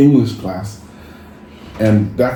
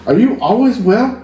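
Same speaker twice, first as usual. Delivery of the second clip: higher, mock-incredulous question; amused question; 9 semitones higher than usual. BYU classmate teasing, higher and incredulous. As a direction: tease him with a rising query. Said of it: "they go".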